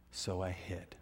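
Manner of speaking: breathy whisper, ashamed; whispered confession. Acted. Adam whispering his confession to God. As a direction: hush it like a guilty secret.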